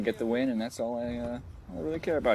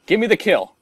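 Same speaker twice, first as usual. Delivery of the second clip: pleading yell; 8 semitones higher, 9 dB louder, slower than usual. Real, not acted. Begging the game for a kill.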